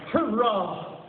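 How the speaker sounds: put-on high, thin child's hurrah; feeble cry. A narrator's reading. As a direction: cheer weakly like a sick little boy. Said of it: "feebly cried".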